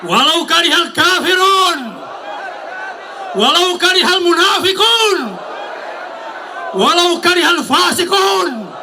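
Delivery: high shouted takbir; rally speech. Acted. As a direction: roar it to the crowd. (pekik takbir lantang bernada tinggi)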